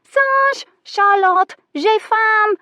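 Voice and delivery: very high, loud squawking bird voice; shrieking parrot. A narrator's reading. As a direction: screech it like a parrot. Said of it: "He sounded insistent and shrill".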